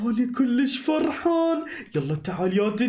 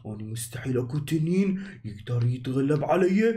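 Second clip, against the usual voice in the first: much lower, boastful dragon; big dragon boasting to the child; 7 semitones lower than usual. Acted. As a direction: rumble it out like the strongest dragon alive. Dragon suddenly speaks back.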